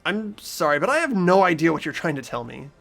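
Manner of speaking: usual speaking voice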